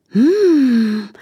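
high pleased mmm; delighted hum. A narrator's reading. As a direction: savor the taste. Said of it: "machte Charlotte". (genießerisches hohes Hm)

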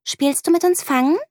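put-on high, bright little girl asking; girl inviting her friend to play tag, high and bright; child inviting. A narrator's reading. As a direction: ask it like a small friend. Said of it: "fragt Lea sie".

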